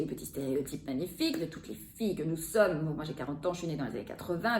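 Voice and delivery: ordinary talk, nothing marked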